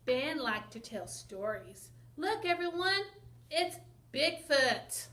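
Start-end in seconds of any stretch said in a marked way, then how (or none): 2.2-5.1 louder call with a slow "it's Bigfoot". Acted. Ben calls the townspeople to see Bigfoot, calling out. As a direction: announce it to the whole town.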